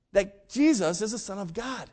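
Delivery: ordinary talk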